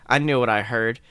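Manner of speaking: ordinary talk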